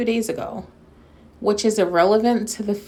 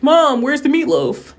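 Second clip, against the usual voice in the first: put-on loud, high man-child yell for mom; Will Ferrell in Step Brothers; 5 semitones higher, 7 dB louder than usual. Grown son calling mom about the meatloaf, comic yelling impression. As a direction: holler it like an overgrown kid demanding dinner. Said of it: "the movie Step Brothers with Will Ferrell".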